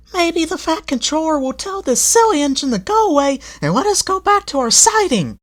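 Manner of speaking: high, loud hopeful coach voice; smug coaches. A narrator's reading. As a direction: gossip smugly. Coaches hoping engine gets sent.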